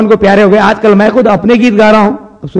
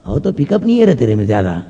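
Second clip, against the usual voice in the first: low doctor; 9 semitones lower, 6 dB quieter than usual. Acted. Doctor's reply.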